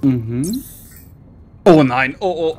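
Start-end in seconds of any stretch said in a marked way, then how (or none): 1.6-2.6 panicking gamer. Real, not acted. Game going wrong.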